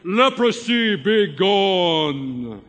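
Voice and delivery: loud, grand prophet proclamation; a mock-prophet command, loud and theatrical; grand shout. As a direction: boom it out like a miracle worker. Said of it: "shouting out something like".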